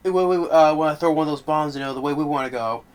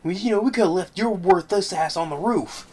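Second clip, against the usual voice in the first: much higher, louder sarcastic barking back; heated argument; 8 semitones higher, 4 dB louder than usual. Retold or imitated. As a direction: throw the insult back in his face. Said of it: "Kevin Bacon gets on his face like".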